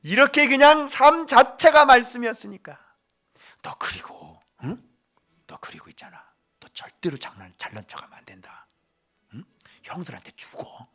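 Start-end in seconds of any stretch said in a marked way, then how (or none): 3.6-10.9 put-on hushed playful warning to a child; whispering grandfather. Grandfather Joseph warns his grandson not to show off, whispering. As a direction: whisper it close, half teasing.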